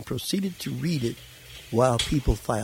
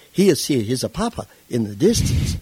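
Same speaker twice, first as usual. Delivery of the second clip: put-on louder Italian-accented English, full of wonder; Italian broken English; 2 semitones higher, 6 dB louder than usual. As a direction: call out like the preacher's son seeing his father far off. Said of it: "as the man in broken English said".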